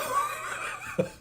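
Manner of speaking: high squealing giggle; playful squeaky laugh. Real, not acted. Laugh after joke.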